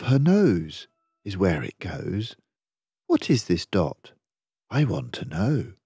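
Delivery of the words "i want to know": ordinary delivery, nothing marked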